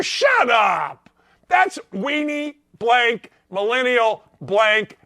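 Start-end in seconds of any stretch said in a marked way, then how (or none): none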